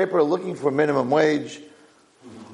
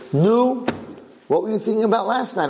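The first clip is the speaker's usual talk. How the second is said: high, eager Yiddish-flavoured questioning; eager owner; 11 semitones higher than usual. Acted. The owner pressing his guard for the night's thoughts, high and eager. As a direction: lean in, dying to hear the answer.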